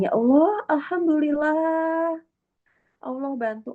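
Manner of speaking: high, slow, fading thankful sigh; grateful prayer. Pretend. Speaker voicing a believer who accepts God's solution, gratefully. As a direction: thank God wholeheartedly. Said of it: "dengan segenap hati bilang".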